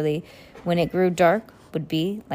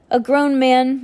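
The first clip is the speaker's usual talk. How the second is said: loud, raised-pitch reprimand; stern; 7 semitones higher, 7 dB louder than usual. A narrator's reading. Mister Poe refusing the children's car chase, stern. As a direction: lay down the rule firmly, voice raised. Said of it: "Mister Poe said sternly".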